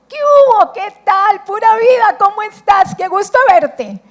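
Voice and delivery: put-on loud, high, gushing hello to an old friend; high excited chatty greeting; gushing friendly greeting. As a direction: greet her like you haven't seen her in years. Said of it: "En el lenguaje nuestro".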